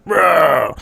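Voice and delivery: roar, imitating a man as monster; mock roar. Monster turns out to be James, mock roar. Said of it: "it's James going".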